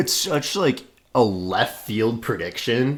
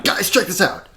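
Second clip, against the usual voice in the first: high-pitched, eager mock announcement; excited bursting in; 8 semitones higher than usual. Busting in the door with Nikes, excited.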